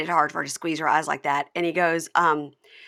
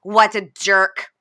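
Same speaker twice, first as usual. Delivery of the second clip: louder, slow biting sarcasm; mock-sweet goodbye dripping with contempt; sarcastic brush-off; 2 semitones higher, 9 dB louder than usual. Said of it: "looks at him and goes".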